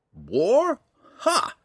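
put-on high, loud scoff from the old ranger; mocking echo. A narrator's reading. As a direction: throw it back and scoff. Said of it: "Montaleo echoed".

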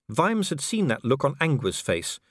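plain reading voice

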